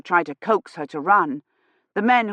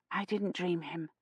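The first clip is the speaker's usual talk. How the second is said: frightened thought; 13 dB quieter than usual. A narrator's reading. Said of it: "she thought frightenedly".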